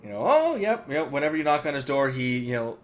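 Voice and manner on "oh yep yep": higher, absent-minded, offhand; a touch of a distracted old wizard; lightly voiced wacky wizard. Asphodels answering the door, distracted and offhand. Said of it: "wizard that's just kinda like".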